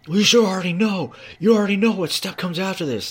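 high, loud mock tirade; imitated foreman yelling. Foreman berating worker about the next step, imitated yelling.

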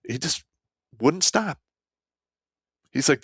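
ordinary talk, nothing marked

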